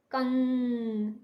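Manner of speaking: कंग held very long, humming the न; drawn-out nasal demo. Shown as a demonstration. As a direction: hum through कंग slowly. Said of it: "न की सी ध्वनि आ रही है ना". (कंग को बहुत खींचकर, न की गूंज के साथ)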